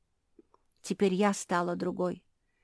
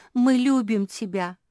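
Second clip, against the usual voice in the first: higher, loud affectionate cry; all in chorus; 4 semitones higher, 8 dB louder than usual. A narrator's reading. Family echoes Феня to Куки, loudly in chorus. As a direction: take it up like a whole group. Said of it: "хором подхватили все".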